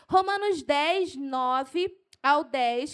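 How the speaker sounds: fired-up preacher citing scripture; carried away. Real, not acted. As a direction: announce it keyed up and loud. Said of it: "eu me empolgo".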